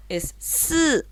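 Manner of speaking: "s" held as a buzzing s with no vowel; just the consonant, no i after it; bare s. Shown as a demonstration. Teacher demonstrates the vowelless sound for students. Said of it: "Y no es si".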